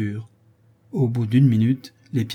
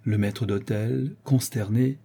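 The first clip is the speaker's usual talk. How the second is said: usual speaking voice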